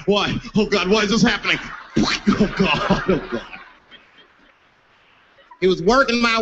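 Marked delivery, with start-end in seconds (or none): 0.1-3.6 comic victim's lament; imitating a despairing passenger; mock anguished whimper. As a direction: whimper it as a helpless hostage. Retold victim obeying humiliating demands.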